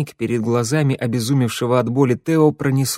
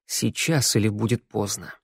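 ordinary delivery, nothing marked

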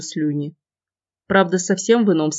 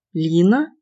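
surprised rising question. Acted. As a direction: ask back in surprise. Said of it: "удивлённо переспросила сестра".

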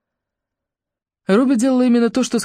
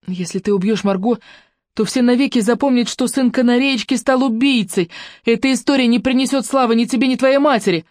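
lightly voiced young woman, higher and insistent; Ruby, urgent; 7 semitones higher than usual. Ruby warns Claude, urgent and higher. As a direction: press him to see sense.

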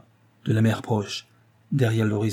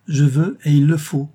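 raised, louder, emphatic insistence; insistent demand; 5 semitones higher, 7 dB louder than usual. A narrator's reading. Wife orders reluctant husband to marry the girl, insistently. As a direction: lay it down as a duty.

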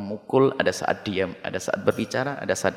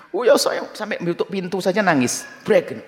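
high, upset woman complaining, acted out; distressed woman; 8 semitones higher than usual. Preacher voicing a woman's upset complaint to him. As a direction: play the crying wife, pitched up.

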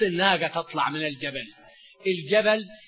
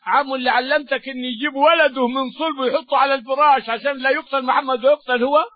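raised, louder sermon telling; impassioned preaching; 6 semitones higher, 5 dB louder than usual. Acted. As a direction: drive the story with fervour. Preacher builds the story.

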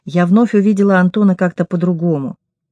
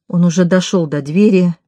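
usual speaking voice

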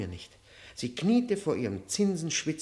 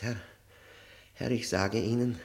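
normal talking voice

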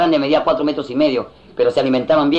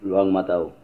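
low, soft, laconic answer; bystander's deep voice; 7 semitones lower, 5 dB quieter than usual. Acted. Bystander answering the effeminate man, deep terse voice. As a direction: drop the pitch right down and state it quietly. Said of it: "contesta el moreno".

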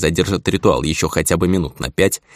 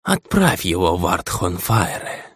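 cold gritted order; slower than usual. Acted. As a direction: bite the words out coldly. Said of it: "приказал он мастеру теней".

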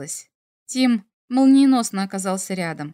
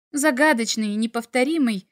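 ordinary delivery

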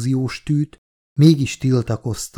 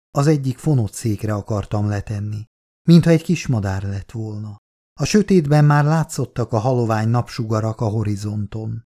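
usual speaking voice

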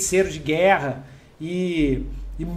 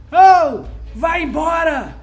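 high, loud guard shouting down; guard shouting from the walls; 7 semitones higher, 5 dB louder, slower than usual. Acted. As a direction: yell down from the city walls.

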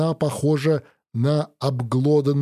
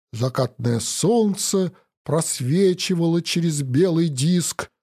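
usual speaking voice

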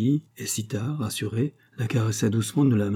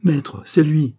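loud, high exclamation of recognition; a slave recognising the captain; excited cry; 6 semitones higher, 6 dB louder, slower than usual. A narrator's reading. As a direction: blurt it out startled. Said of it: "qu'il s'écria".

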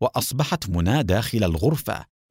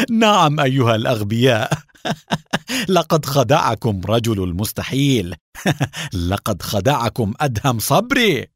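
gloating bursts of acted laughter between phrases; talking through laughter; 2 semitones higher, 3 dB louder than usual. A narrator's reading. Qadri mocking his captors, laughing between words. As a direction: laugh at the fools as you speak. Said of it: "قال من خلال ضحكاته".

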